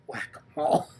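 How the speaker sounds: softly whispered joke in a theater; whispered quip. Retold or imitated. Whispering to Justin in the theater, a quiet joke. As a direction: whisper it to your friend. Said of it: "I turned to Justin and I go".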